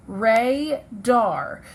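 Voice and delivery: "Radar" as two drawn-out syllables, RAY... DAR; split into syllables. Shown as a demonstration. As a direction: say "Radar" slowly with a clear break after Ra. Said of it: "hear the natural break in the syllables".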